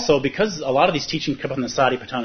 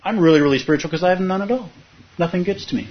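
imitating a smug, aloof meditator, pitched higher; lightly voiced know-it-all claiming nothing touches him; a hint of a lofty poser, higher up; mock smug detachment; 7 semitones higher than usual. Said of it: "Or the opposite".

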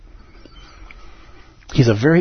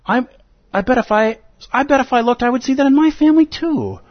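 higher, eager mock listener voice; imagined listener; 8 semitones higher than usual. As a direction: play a listener realizing it all at once. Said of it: "you're like".

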